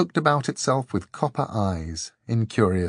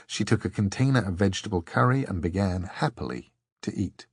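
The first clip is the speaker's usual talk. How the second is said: ordinary delivery, nothing marked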